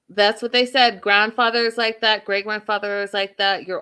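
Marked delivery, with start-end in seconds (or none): none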